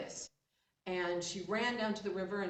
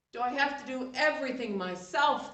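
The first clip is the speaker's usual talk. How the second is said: higher, louder fed-up complaint; exasperated outburst; 7 semitones higher, 10 dB louder than usual. Acted. Chief complains loudly at his servants, exasperated. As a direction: throw up your hands.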